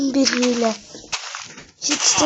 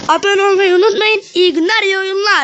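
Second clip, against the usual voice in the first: bright, raised sign-off to viewers; higher, louder cheerful goodbye; excited outro; 6 semitones higher, 4 dB louder than usual. Real, not acted.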